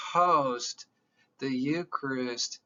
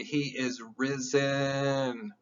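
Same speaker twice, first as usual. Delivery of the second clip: usual speaking voice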